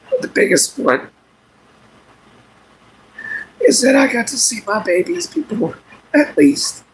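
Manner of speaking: fighting back tears, voice breaking up; crying. Real, not acted. Tearful to his stream viewers about his babies, voice breaking. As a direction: voice cracking high through real tears. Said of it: "He don't like watching me cry".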